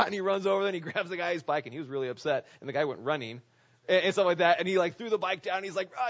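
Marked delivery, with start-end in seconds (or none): 5.9-6.1 short mock roar; imitated angry yell. Preacher imitating pastor yelling at the biker, angry roar. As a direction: roar at someone like the Hulk. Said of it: "he's yelling at the guy".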